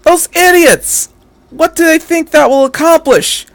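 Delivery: high, exasperated cry; horrified outburst. A narrator's reading. Misato reacting to the army's last stand, horrified and exasperated. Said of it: "Misato looked horrified".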